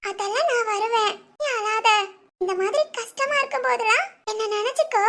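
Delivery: usual speaking voice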